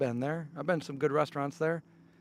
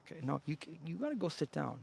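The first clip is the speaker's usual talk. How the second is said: usual speaking voice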